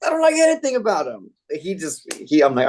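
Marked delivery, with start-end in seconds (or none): 0.1-1.3 high-pitched mock indignation; imitated incredulous disgust. Customer answering a stupid question about Edison, imitated high indignant disbelief. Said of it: "looked at me like I was an alien".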